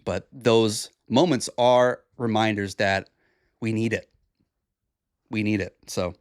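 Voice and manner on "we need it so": normal talking voice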